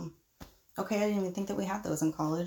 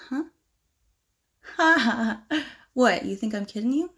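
ordinary talk

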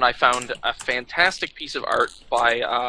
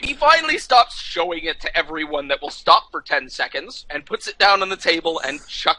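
normal talking voice

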